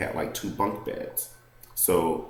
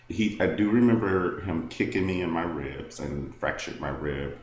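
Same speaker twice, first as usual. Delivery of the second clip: usual speaking voice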